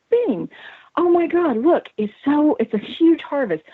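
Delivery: high, bursting with joy; thrilled at a huge harvest; euphoric excitement. Retold or imitated. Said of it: "in this happy, euphoric".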